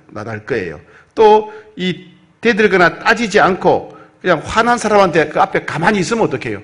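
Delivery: ordinary talk, nothing marked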